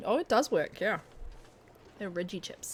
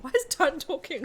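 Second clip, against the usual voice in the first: surprised; 8 semitones higher, 8 dB louder than usual. Retold or imitated. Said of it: "I was just like".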